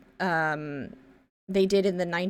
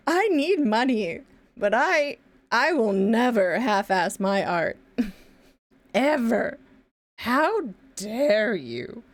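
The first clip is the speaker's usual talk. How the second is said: slow, emphatic melodramatic outrage; hammed-up righteous offence; mock indignation; 2 semitones higher, 3 dB louder, slower than usual. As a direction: play the offended artist, leaning on each word. Acted indignant reply to someone.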